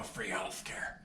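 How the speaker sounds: hushed whisper; whisper mock. Boss mocking Jessica's idea in a whisper. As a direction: breathe it like a dirty secret.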